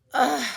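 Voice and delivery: loud pained grunt; pained groan. Acted. The shot creature groaning in pain. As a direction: let out a short, loud groan.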